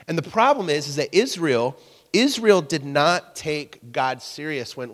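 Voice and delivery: ordinary delivery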